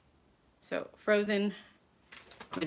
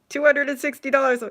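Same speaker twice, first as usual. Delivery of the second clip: louder, higher announcing of the shocking total; shocked at total; 7 semitones higher, 11 dB louder than usual. Retold or imitated. At the checkout, hearing a stranger's bill, shocked loud. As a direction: blurt the price in disbelief. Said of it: "It's like".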